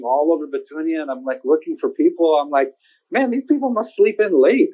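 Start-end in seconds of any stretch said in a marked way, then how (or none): 3.1-4.7 high puzzled inner voice; higher voice of a puzzled teenager; puzzled teen. Retold or imitated. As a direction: wonder aloud, higher and baffled. Teen's thoughts, voiced puzzled.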